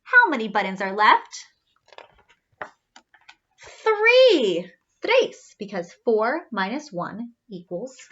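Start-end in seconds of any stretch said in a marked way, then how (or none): 3.6-5.5 high, loud counting call; excited high call; excited answer. Acted. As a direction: shout the answer gleefully.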